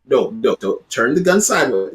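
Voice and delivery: ordinary delivery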